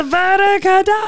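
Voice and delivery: Voldemort spell imitation. Quoting a TikTok trend to a friend, hammed-up incantation. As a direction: cast it in a hammed-up high villain voice.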